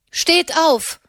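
a high, firm order to get up; sharp command from a young nun. Acted. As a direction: order the dying man to his feet. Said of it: "befahl Katharina nochmals".

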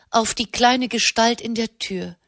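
usual speaking voice

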